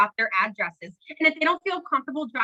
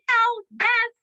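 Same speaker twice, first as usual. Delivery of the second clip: much higher, loud burst of yes; excited cheer; 12 semitones higher, 4 dB louder than usual. Real, not acted. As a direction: shout it like a cheerleader. Answering her own question with enthusiasm.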